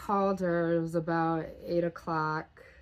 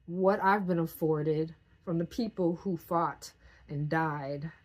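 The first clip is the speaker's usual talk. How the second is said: normal talking voice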